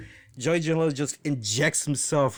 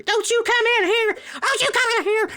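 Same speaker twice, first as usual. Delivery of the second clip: very high, fast and loud, full squeal; shrill mock of the ref telling him off; high-pitched referee voice; 5 dB louder than usual. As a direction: squeak it out like an indignant little referee. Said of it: "that's how he sounds".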